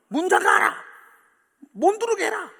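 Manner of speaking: high, loud anguished command; God crying out in hurt to shut the door; grieved shout. Retold or imitated. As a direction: cry it out like a hurt father. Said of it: "그렇게 지금 외치고 있는".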